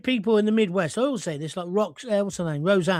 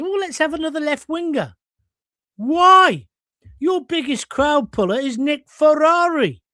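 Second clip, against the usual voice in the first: normal talking voice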